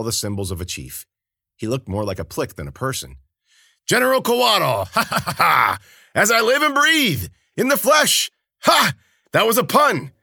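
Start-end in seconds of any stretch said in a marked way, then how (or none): 3.9-10.1 booming, high jolly laughing voice; a loud, merry old drunk bellowing his greeting; hearty high-pitched bellow with laughter; boisterous Kabata. A narrator's reading.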